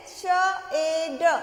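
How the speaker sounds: श ए ड sounded out separately; letter sounds. Shown as a demonstration. Teacher showing children Hindi sounds, श ए ड said sound by sound. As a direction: break the word into three sounds, श ए ड. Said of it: "क्या बोलेंगे".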